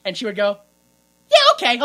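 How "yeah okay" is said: high, loud, eager yes; imitated instant acceptance, pitched way up; mock gullible agreement. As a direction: chirp it as an all-too-trusting girlfriend. Acts gullible girlfriend's answer.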